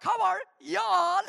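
put-on slow, loud Southern y'all greeting in a Texan accent; playful Texan accent; mock Texan drawl. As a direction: show off a Texan "How are y'all?". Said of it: "Do I sound like real Texan?".

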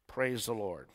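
ordinary talk

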